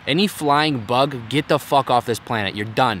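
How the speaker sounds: ordinary delivery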